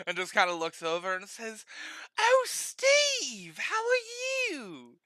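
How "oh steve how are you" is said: put-on high, drawn-out old lady greeting; high sweet old lady. Barber greeting an old friend, high slow old lady voice. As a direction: stretch it up high like a delighted granny.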